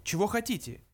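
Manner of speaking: understated stern query; stern challenge. A narrator's reading. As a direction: demand an answer with a touch of threat. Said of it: "грозно спросил Ланквилл".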